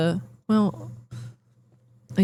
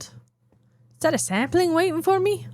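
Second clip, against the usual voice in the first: high cutesy voice; 10 semitones higher than usual. Pretend. Talking to a game sapling.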